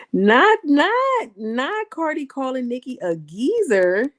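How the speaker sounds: high, loud incredulous cry; can't believe what she said; stunned disbelief. Real, not acted. Disbelief at the insult.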